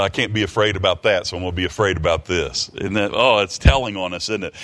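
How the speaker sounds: ordinary talk, nothing marked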